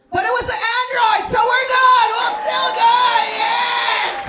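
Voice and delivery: high, loud, crowd-rousing boast; triumphant shouting. Real, not acted. Comic pumping up the crowd, shouting proudly. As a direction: belt it out like a victory chant.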